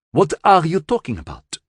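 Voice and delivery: demanding. Acted. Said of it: "Western Europe demanded".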